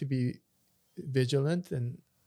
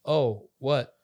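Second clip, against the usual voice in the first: mildly startled, louder reply; imitating startled man; 6 dB louder than usual. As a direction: act out someone caught off guard. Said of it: "he got startled and he said".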